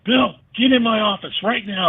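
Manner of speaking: high-pitched retold summons; mock stern manager. Manager calls the player to his office after the game, mock stern. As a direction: imitate a manager ordering a player in.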